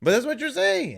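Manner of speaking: shouting through laughter; laughing shout. Real, not acted. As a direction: yell it while laughing. Laughing shout during banter.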